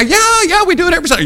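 high-pitched, overeager backing-up; eager fibbing teen. Retold or imitated. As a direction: chime in high, insisting it's routine. Bus teen eagerly backing the lie.

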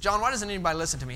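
fast whiny complaint. Retold or imitated. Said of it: "I said".

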